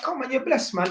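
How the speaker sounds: high, coaxing dismissal of worry; friendly reassurance. Retold or imitated. Tenant tapping dad on the arm, reassuring.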